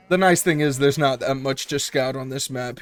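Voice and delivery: ordinary talk, nothing marked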